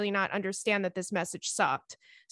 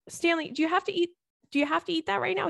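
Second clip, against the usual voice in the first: higher, exasperated call to the pet; scolding the dog; 7 semitones higher, faster than usual. Real, not acted. To Stanley chewing a shoebox, higher exasperated scold. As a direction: catch the dog misbehaving, pitch jumps up.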